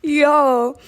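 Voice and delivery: high, loud yo; excited yo. Retold or imitated. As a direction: call out in delight. Retold friend's reaction.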